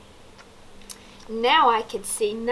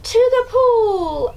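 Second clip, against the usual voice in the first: higher, louder, slow shout; yelled; 12 semitones higher, 6 dB louder, slower than usual. A narrator's reading. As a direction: call it out eagerly. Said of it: "I yelled".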